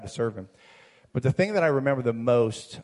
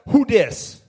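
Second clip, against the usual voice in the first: high, loud tough-guy "who dis"; thug voice; 11 semitones higher, 10 dB louder than usual. Retold or imitated. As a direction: answer the phone like a thug. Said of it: "in the best thug voice I can".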